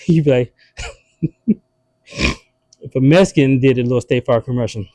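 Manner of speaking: normal talking voice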